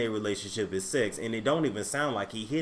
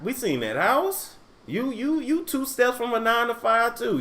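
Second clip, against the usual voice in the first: high scolding rant; 9 semitones higher, 4 dB louder than usual. Real, not acted. Scolding Jess, voice climbs high.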